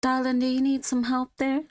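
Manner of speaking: a florist sidling up to a customer, light southern twang. Acted. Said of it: "slightly giving a southern twang to her".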